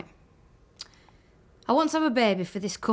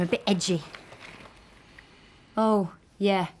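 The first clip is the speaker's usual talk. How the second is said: usual speaking voice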